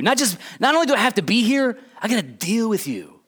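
aggravated complaint, starting high then dropping; fed-up paraphrase of a rebuke, not yelled; upset Jesus. Acted. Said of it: "Jesus sounds like he's upset".

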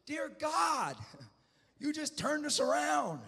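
high, loud anguished plea, re-enacted; acted desperate prayer at full voice; crying out to God. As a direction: cry it out slowly to heaven. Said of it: "I'm just crying out".

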